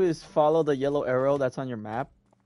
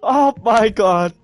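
frantic shouting; 9 semitones higher, 9 dB louder, much slower than usual. Real, not acted. Frantic in game.

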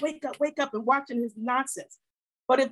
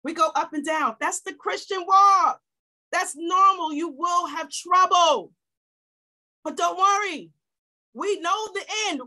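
preaching shout; 4 semitones higher than usual. Real, not acted. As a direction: proclaim it like a hard truth to the pews. Pastor exhorting church.

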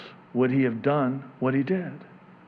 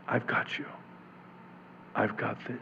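whispered reassurance; 3 semitones lower, 10 dB quieter than usual. Acted. Preacher voices Jesus comforting listener.

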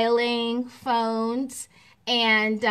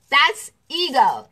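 ordinary talk, nothing marked

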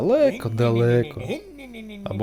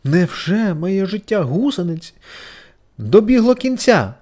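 high, slow, louder anxious lament; worried; 8 semitones higher, 5 dB louder, slower than usual. A narrator's reading. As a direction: dread the end slowly. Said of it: "схвилювалася гусениця Сората". (стривожена гусениця)

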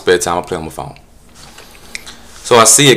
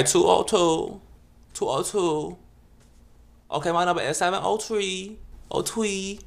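higher, lilting numbers; mock lisping numbers; 8 semitones higher, 3 dB quieter than usual. Solicitor reciting his number, lilting and high. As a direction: sing the digits out lightly.

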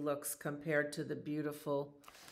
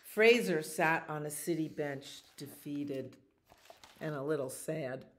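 lively, slightly louder storybook voice; animated picture-book narration; reading to children; 4 dB louder than usual. A narrator's reading. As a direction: tell the story to little listeners. Reads picture book aloud.

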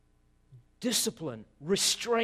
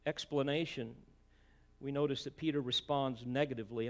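normal talking voice

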